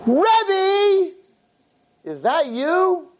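high, loud cry of recognition; stretched high-pitched shout; excited scream. Retold or imitated. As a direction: scream it out in disbelief from behind. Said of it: "someone screams from behind me".

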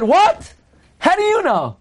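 high-pitched, astonished retort; startled disbelief. Retold or imitated. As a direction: act out a taken-aback "what?!". Reuven's startled retort in retold exchange.

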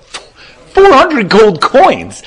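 loud, high, drawn-out incredulous cry; stunned disbelief. Retold or imitated. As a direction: repeat the price in utter shock, stretching every word. Rich man balks at the price.

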